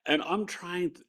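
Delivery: ordinary delivery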